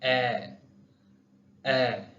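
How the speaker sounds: ए said twice with stress; stressed vowel. Shown as a demonstration. Teacher models the stressed e. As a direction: hit ए firmly. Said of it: "stressed".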